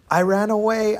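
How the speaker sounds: lightly acted little boy; timid boy. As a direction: admit it like a nervous kid. Timid boy confesses to giant.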